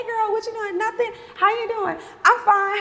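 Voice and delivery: high, bright sing-song friend-call voice; mock breezy call.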